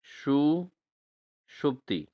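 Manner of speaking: सुषुप्ति drawn out very slowly, each syllable stretched; teacher dwelling on सुषुप्ति; slow model word. Shown as a demonstration. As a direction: stretch सुषुप्ति long for the class. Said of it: "क्या है दोस्तों?".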